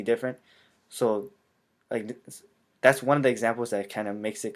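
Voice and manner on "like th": ordinary talk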